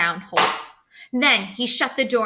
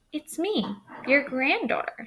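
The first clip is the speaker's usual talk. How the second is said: put-on little girl voice; 6 semitones higher than usual. Girl answers at door.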